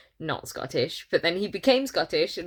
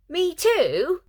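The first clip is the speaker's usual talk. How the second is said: higher, mocking parrot of the line; mocking reply; 11 semitones higher, 5 dB louder than usual. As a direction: ape the clumsy dialogue a bit higher. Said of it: "the other character replies".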